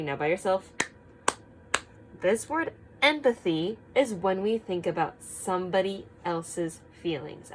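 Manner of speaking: normal talking voice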